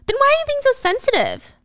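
very high, whiny incredulity; exasperated demand. Acted. Acted boyfriend exasperated after the reveal.

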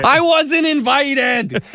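high, drawn-out pretend wail; hammed-up shout of complaint, slow and stretched; mock aggrieved yelling. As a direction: holler it like a left-out neighbor in the street. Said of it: "I'm gonna go out in the street".

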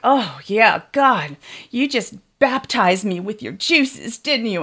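ordinary talk, nothing marked

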